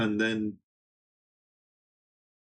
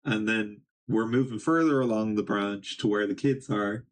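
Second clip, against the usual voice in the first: normal talking voice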